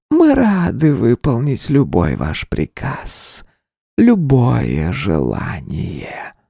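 slow breathy siren voice fading to a whisper; seductive whisper. A narrator's reading. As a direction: tempt him slowly, ending in a whisper. Spider siren seduces king. (соблазнительный шёпот сирены)

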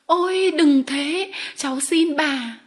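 high, loud, drawn-out begging; desperate pleading. A narrator's reading. Remy begging the lady not to write, desperate plea. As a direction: beg her slowly and urgently to stop.

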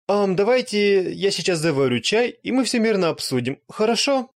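high, loud meek appeasing offer; forced smile, begging for peace; nervous vampire. Acted. Beaten vampire offers tea.

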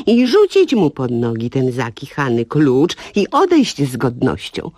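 usual speaking voice

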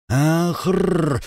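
high strangled wheeze; choked wheeze. Acted. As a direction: choke out a rasping gasp. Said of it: "захрипел имперец".

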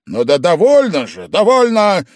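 loud, rising exasperated outburst; angry shout. A narrator's reading. Host cutting off his drunk guest, angry shout. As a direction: shout him down, pitch climbing. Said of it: "опять рассердился и закричал Вельчининов".

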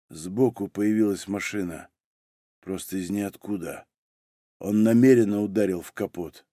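low, subdued, lifeless account; colourless voice. A narrator's reading. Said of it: "бесцветным голосом сказал Малинин". (глухо, медленно, бесцветно)

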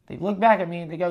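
ordinary talk, nothing marked

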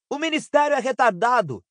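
ringing, high-pitched announcement; resonant voice. A narrator's reading. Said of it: "em voz ressonante".

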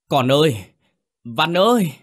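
high, loud desperate wail for a lost son; anguished cry. Acted. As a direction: call out to the river in despair. Mother cries for drowned son.

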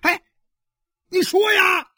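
very high, loud yell; enraged shout. Acted. Husband presses his silent wife, shouting. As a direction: bellow for an answer.

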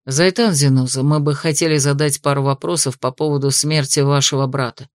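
a hint of a stern investigator, low. A narrator's reading. Investigator addresses drunk, lowered voice.

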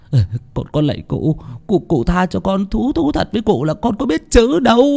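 rising, desperate begging for mercy; begging pleading. A narrator's reading. Servant Nô begging the master for mercy, terrified. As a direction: plead on your knees. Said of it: "chắp tay lạy lục".

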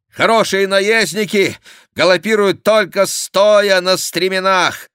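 loud, high galloping Gascon; shouted riding advice. A narrator's reading. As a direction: call the advice out over the gallop. Chicot shouts advice while galloping. (громкий совет на скаку)

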